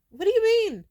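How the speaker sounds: high, loud incredulous what do you mean; genuinely baffled, voice jumps up high and loud; exasperated disbelief. Real, not acted. Vlogger baffled by hate comments, high loud disbelief.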